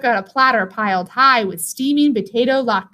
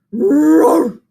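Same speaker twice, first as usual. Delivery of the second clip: bear roar; 5 semitones higher, 5 dB louder than usual. Acted. Bear at the oven.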